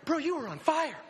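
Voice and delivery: high, animated exclamation; retold amazed outburst; excited disbelief. Driver telling the rider afterward, excited disbelief.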